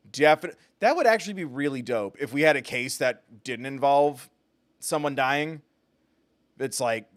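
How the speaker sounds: ordinary talk, nothing marked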